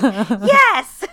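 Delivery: loud, gleeful yes through laughter; laughing shout. Retold or imitated. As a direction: burst out with it while laughing. Joking answer to retold question.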